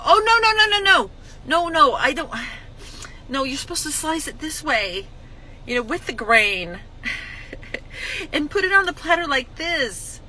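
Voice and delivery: snatch the job back, fussing; bossy correcting host. Retold or imitated. Host correcting a helpful guest, high fussy protest. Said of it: "and you go".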